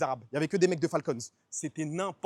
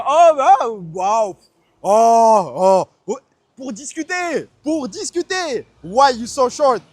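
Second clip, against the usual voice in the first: much louder, higher, stretched-out astonished cries; hammed-up amazement; 5 semitones higher, 13 dB louder, much slower than usual. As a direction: overplay his stunned, thrilled reaction. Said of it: "Je dis".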